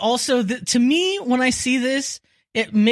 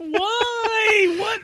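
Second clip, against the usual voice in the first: laughing outrage; 14 semitones higher than usual. Real, not acted. Reacting to price.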